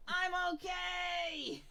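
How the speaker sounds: faint, high, very drawn-out call; distant shout. Acted. Trapped friend answering from behind fallen rocks, distant shout. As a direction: call back as if from far away. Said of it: "And you hear in the distance".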